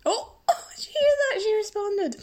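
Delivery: delighted laughing squeal at her cat's reply. Real, not acted. Host thrilled that her cat answered, high laughing squeal.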